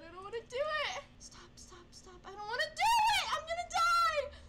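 high, fast, tearful cries; terrified wailing. Real, not acted. Scared gamer refusing to play on, high fast tearful wails. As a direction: wail it in real fear.

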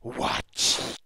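loud, harsh whispered monster call; Goblin-speaking bugbear. Acted. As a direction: rasp it out as an unseen creature. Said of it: "Say it again".

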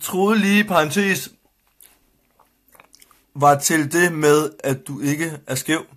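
slow, word-by-word read-out; reading a comment aloud. Real, not acted. Reading a viewer's comment to his co-host, slow deliberate read-out. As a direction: spell out a puzzling message, phrase by phrase.

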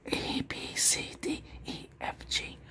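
unvoiced letters whispered slowly. Shown as a demonstration. Examiner testing the viewer's hearing with letters, whispered. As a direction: whisper the alphabet softly.

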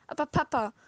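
put-on little girl cries out, begging; crying out. A narrator's reading. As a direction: call out to Papa, desperate. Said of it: "rief das kleine Mädchen".

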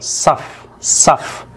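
whispered صف, isolated syllable; صف whispered on its own. Shown as a demonstration. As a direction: breathe صف out as the stressed syllable. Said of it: "التشديد على المقطع الأول".

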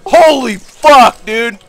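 loud, high, drawn-out, slow; stunned exclamation. Real, not acted. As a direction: stretch it out in disbelief. Stunned after the fight.

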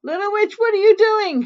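high, loud shriek from another room. A narrator's reading. Mother witch checks on Little Witch from another room, shrieking. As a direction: shriek it as if through the house. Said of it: "shrieked Mother Witch from the other room".

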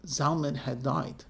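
plain reading voice

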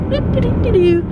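cat voice. Pretend. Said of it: "she's gonna be like".

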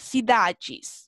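"cidades" slowly, see-DAH-djis; DE plus S as "djis". Shown as a demonstration. Teacher repeating the rule for final S, "cidades" ending "djis". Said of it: "se pronuncia como G".

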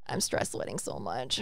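low, breathy laugh through the words; laughing confession. Real, not acted. Admitting nerves to her cohost, laughing low. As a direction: giggle it out, embarrassed.

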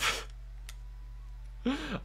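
high breathless wheeze of laughter; wheezing laugh. Real, not acted. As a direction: laugh until no sound comes out. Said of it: "the hardest I have ever laughed".

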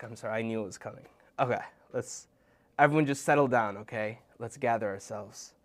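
plain reading voice

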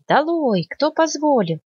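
high excited mock outcry; forest crowd shouting. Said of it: "помахали лапами и закричали". (возмущённые крики леса)

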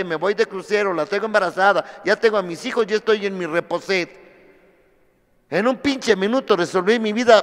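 ordinary talk

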